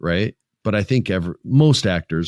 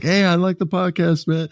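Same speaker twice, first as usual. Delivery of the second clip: 1 fan greeting; 10 semitones higher than usual. Retold or imitated. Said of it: "be like".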